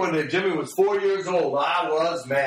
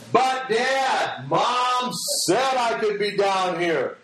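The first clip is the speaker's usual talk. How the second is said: put-on higher, drawn-out child's protest; protesting little boy; 4 semitones higher, much slower than usual. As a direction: plead your case like a small son. Young son protests to his father.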